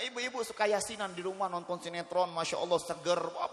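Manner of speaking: ordinary delivery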